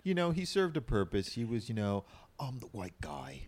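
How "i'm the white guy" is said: slow, soft, mock bland American accent; bad American accent. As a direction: drone it in a fake generic American accent. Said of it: "His horrible American accent".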